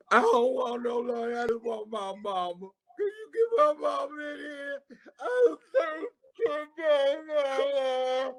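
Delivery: high, wailing mock sob for mama; mock crying. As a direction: blubber like a grown man crying for his mother. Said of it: "He was crying for his mother".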